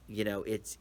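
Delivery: ordinary delivery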